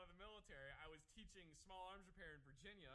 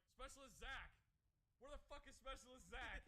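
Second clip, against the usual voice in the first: mock roll call, pitched up; roll-call shout; 6 semitones higher than usual. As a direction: call out for a missing soldier, mildly raised. Acted roll call, calling out.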